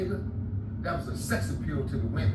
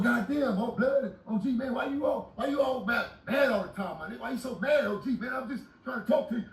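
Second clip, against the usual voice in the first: mimicking a whiny, higher young man; young cellmate protesting, higher voice; 7 semitones higher than usual. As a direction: play the complaining cellmate, pitched up. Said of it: "He goes".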